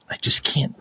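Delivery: embarrassed breathy whisper. Real, not acted. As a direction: give up in a whisper.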